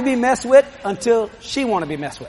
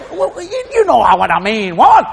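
flustered husband. Retold or imitated. Husband dodging.

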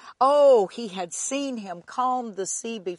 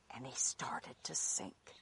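whispered; 9 semitones lower, 15 dB quieter than usual. A narrator's reading. Dramatic narration of sinking.

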